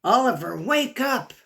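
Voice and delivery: ordinary delivery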